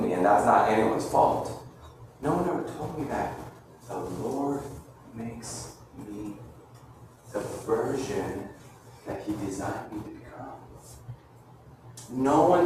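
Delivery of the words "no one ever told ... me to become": much softer, lower, intimate; hushed awe. Real, not acted. Preacher confessing his discovery to listeners, hushed. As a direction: let it sink in, almost whispering.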